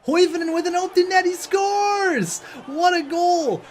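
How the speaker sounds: high, loud play-by-play scream of joy; excited goal shout. Real, not acted. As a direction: yell it like the series just ended. Overtime goal call, shouting.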